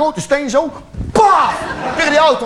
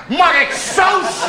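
high, very slow shouted request for sauce; drawn-out ask; 7 semitones higher, much slower than usual. Acted. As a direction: stretch it out to annoy. Asks for sauce mid-meal. (heel traag geroepen vraag om saus)

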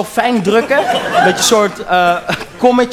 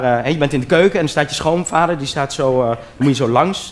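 usual speaking voice

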